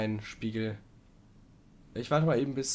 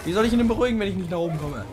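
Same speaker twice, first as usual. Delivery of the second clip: higher, louder exasperated complaint; frustrated outburst; 6 semitones higher, 6 dB louder, faster than usual. Real, not acted. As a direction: vent the frustration. Frust, kommt nicht hoch. (lauter, frustrierter Ausbruch)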